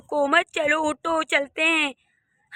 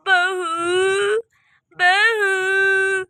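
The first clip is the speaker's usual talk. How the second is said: long drawn-out call to someone out of sight; 3 semitones higher, much slower than usual. Acted. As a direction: call for the daughter-in-law, stretching the word. Sends boy, then calls daughter-in-law. (लंबी खींची हुई पुकार)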